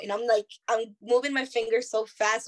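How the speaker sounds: normal talking voice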